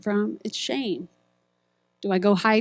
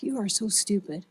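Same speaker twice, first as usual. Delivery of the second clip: softer, muttered self-insults; self-cursing; 6 dB quieter than usual. Retold or imitated. Speaker voicing the inner self-cursing, softer. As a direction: berate yourself under your breath. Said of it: "we start saying things like".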